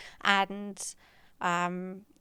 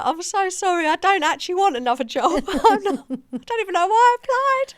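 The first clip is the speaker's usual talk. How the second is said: higher and louder, giggling through the words; laughing apology; 10 semitones higher, 7 dB louder than usual. Retold or imitated. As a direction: apologize while cracking up. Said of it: "We were all just laughing so much".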